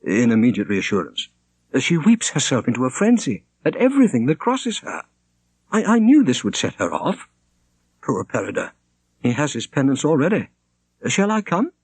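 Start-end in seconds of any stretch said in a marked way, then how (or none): 1.7-11.7 a hint of a troubled young woman, higher; lightly voiced young woman. A narrator's reading. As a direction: Shonerd pleading with a touch of sadness. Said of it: "said Shonerd".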